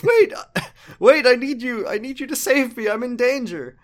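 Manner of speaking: high, loud desperate plea, laughing; high pleading punk. Retold or imitated. Crazy Kyo begs Kiryu for help, high and pleading. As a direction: beg like a panicked cartoon kid. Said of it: "he says".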